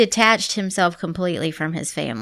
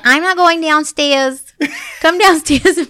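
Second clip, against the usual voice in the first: high, louder imitation of a scared kid; hammed-up frightened child; mock child's refusal; 8 semitones higher, 5 dB louder, slower than usual.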